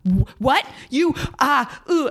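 a hint of bewildered older men; flustered older men. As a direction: fumble the words like men caught off guard. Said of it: "these older men are just sort of like".

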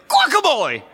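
high, loud gleeful shout; excited shout. Retold or imitated. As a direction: belt it out with glee. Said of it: "But he really got into it".

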